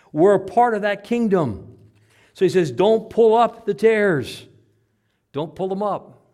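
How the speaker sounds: loud, high, emphatic sermon delivery; full-force preaching. Real, not acted. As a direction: drive each phrase home to the congregation.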